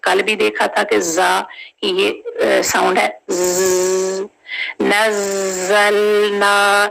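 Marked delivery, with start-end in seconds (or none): 4.8-6.9 नज़लना slow with the shadda held; slow recitation; tajweed recitation. Shown as a demonstration. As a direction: hold the ज़ in नज़लना. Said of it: "उसको हमने थोड़ा सा hold करना".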